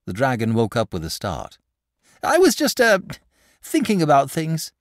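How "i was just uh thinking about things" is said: simple way. A narrator's reading. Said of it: "he said in his simple way".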